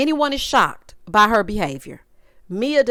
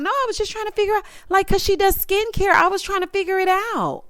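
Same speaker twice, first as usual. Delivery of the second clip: high, rushed mock-innocent excuse; high-pitched defensive babble, acted out; feigned confusion; 8 semitones higher than usual. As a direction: imitate someone playing clueless. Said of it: "Ashley is acting confused".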